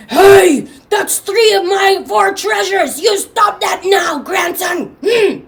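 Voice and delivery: loud, high indignant scolding; mad. Acted. Grandfather scolding grandson over three lost treasures, loud anger. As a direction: shout a telling-off, ending in a huff. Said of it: "But he's mad. He says".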